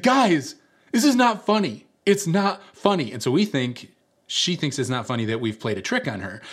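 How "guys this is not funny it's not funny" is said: high, loud imitation of an upset friend; mock indignant protest, pitched right up; upset scolding. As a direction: scold the laughing group in a raised voice. Upset girl scolds laughing friends.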